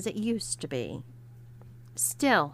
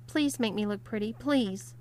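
ordinary delivery, nothing marked

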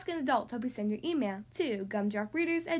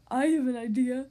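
louder, eager monster helper; Frankenstein voice; 5 dB louder than usual. Acted. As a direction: pitch a big bright idea. Frankie Stein offers help.

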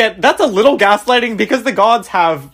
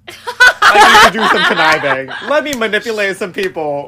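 high, hammed-up schemer; mocking Octavian; 6 semitones higher, 3 dB louder than usual. Host mimicking Octavian plotting to manipulate people, mocking. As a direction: play the conniver with a high, mocking lilt. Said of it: "Octavian being like".

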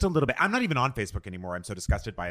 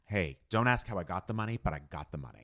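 low, soft shady hiss; low threatening jittery hiss; 7 semitones lower, 8 dB quieter than usual. Acted. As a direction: hiss it like a nervous crook. Mock shady money talk.

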